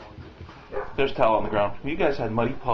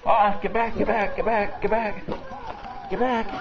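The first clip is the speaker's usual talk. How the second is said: high coaxing; 7 semitones higher than usual. Real, not acted. Pushing dogs back at the door.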